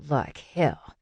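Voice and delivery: mild low growl. A narrator's reading. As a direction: growl it under restraint. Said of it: "Caleb growled".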